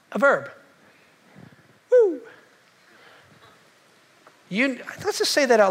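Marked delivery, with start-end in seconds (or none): 1.9-2.2 excited whoop. Real, not acted. Pastor whoops at own line.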